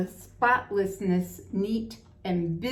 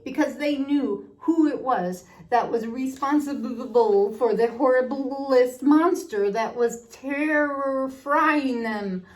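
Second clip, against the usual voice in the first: dramatic; 5 semitones higher, 5 dB louder than usual. A narrator's reading. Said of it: "added Tigger dramatically".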